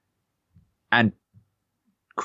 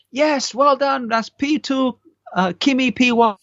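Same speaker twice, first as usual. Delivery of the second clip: imitating an excited engineer, pitched much higher; excited team radio; slower than usual. Race engineer cheering Vettel over radio, high excited mock. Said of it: "They were like".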